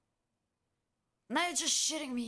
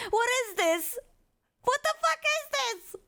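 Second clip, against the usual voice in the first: incredulous; 8 semitones higher, 4 dB louder than usual. Real, not acted. Disbelief at video.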